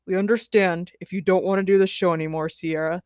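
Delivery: crestfallen. A narrator's reading. Producer conceding to Sierra, mildly crestfallen.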